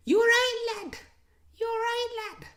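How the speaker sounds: very high-pitched mock Scouse accent. Streamer imitating Frimpong greeting Gravenberch, high-pitched Scouse impression. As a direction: squeal it in a put-on Scouse accent, well above your own voice. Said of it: "he speaks to him in a Scouse accent".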